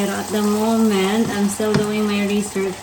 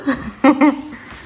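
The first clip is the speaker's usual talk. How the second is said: happy laugh; 4 semitones higher than usual. Real, not acted. Mom laughs with baby.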